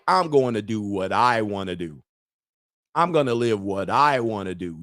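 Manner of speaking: low, deliberate retold stand; firm declaration. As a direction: lay it down like a final word. Retelling his firm stand.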